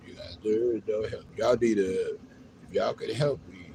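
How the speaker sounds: ordinary talk, nothing marked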